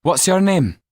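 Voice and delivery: a brusque official demand; clerk's voice. A narrator's reading. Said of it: "the clerk at the desk asked".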